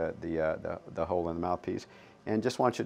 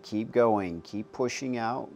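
drawn-out coaching; 3 semitones higher, slower than usual. Real, not acted. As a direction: pull the effort out of them, slowly. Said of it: "I'll still coach you to keep blowing".

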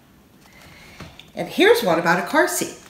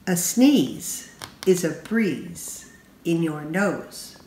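ordinary delivery

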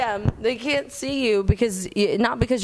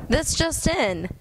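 slow, higher-pitched breaking-news announcement; mock newsreader; 5 semitones higher, slower than usual. Adult announcing a changed favorite to child, mock newscaster.

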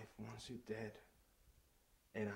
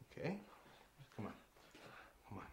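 usual speaking voice

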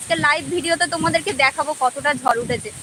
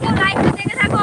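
ordinary talk, nothing marked

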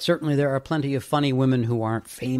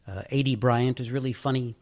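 ordinary talk, nothing marked

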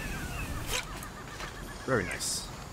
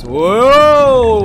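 loud high whoa; startled whoa; 8 semitones higher, 10 dB louder than usual. Real, not acted. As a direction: swept off balance. Swept by water current.

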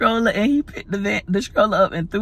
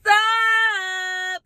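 building greeting call; 13 semitones higher, much slower than usual. Acted. Vlogger greets her viewers, rising to a shout. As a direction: hype the intro, bigger every time.

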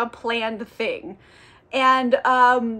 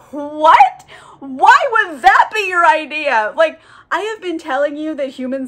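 loud, higher mock-incredulous outburst; hammed-up indignant disbelief; appalled disbelief; 4 semitones higher, 7 dB louder than usual. Angel reacts to God inventing hell, mock appalled. Memnoch appalled at God's idea.

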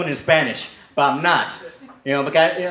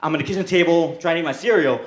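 ordinary talk, nothing marked

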